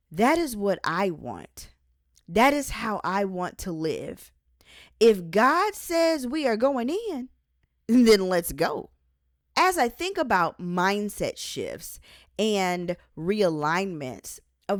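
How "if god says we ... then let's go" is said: higher and louder, fired up; a bold call to step forward; rallying cry. Acted. Urging readers to go where God leads, fired up and loud.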